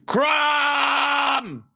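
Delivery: full battle-cry shout, high and loud; Crom scream. Pretend. S1 shows the Crom battle cry, shouting. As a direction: bellow the name like a barbarian war cry.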